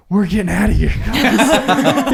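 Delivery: high, loud laughing panic; panicked exit. Retold or imitated. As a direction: blurt it while bolting away. Friend fleeing the woods, laughing.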